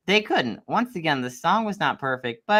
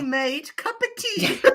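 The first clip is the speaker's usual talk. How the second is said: much higher cartoon British accent; hammed-up British accent; 13 semitones higher, slower than usual. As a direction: squeak out cup of tea like a stage Brit. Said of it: "I can't do a British accent".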